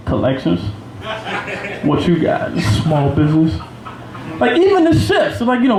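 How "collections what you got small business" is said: low, terse jailhouse imitation; mock inmate. New hires swapping departments like inmates, mock tough and low. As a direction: drop it low like a sizing-up convict.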